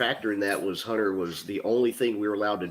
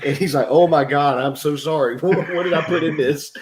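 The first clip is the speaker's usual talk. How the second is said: loud, laughing retelling of chaos; laughing, heated retelling; 7 semitones higher, 10 dB louder than usual. Retold or imitated. Father-in-law panics at spicy spaghetti, loud and laughing. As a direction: tell it big and breathless, laughing.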